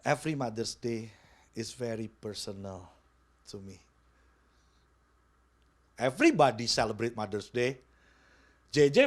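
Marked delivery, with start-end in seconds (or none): 0.0-3.8 low, much softer quote; retelling a friend's words, hushed; quoted softly. Retold or imitated. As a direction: say it gently and slowly. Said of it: "yang Konanda sampaikan gini".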